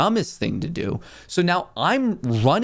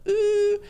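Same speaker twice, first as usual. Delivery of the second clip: long, high-pitched "ooh"; imitated ooh. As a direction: hold a very high, level "ooh". Teller imitates a sound while waiting.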